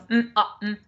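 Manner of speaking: "mm, uh, mm" as separate bouncy pure sounds; clipped phonics segmenting of "mm, uh, mm"; short and clipped. Shown as a demonstration. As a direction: sound out "mm, uh, mm", each one cut short. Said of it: "really short and clipped".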